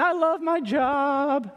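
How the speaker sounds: high, slow, sing-song delight; like skipping through a meadow; carefree joy. Retold or imitated. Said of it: "skipping and whistling through a meadow".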